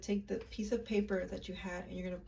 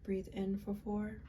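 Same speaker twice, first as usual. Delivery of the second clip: guided meditation; 9 dB quieter than usual. Acted. Guiding viewers through a ritual visualization, soft and calm.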